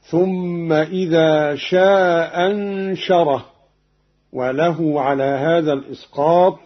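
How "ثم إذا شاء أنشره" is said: slow recitation, شاء أنشره run as "shaa anshara" with short elongation; first hamza dropped, short. Shown as a demonstration. Teacher shows Qalun's reading, short vowel without first hamza. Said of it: "بإسقاط الهمزة الأولى".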